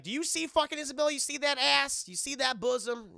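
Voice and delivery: high, loud, fast incredulous questions; hammed-up excited comic sidekick; excited ogling. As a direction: rattle it off in high-pitched excitement. Acts Chris Tucker excitedly ogling.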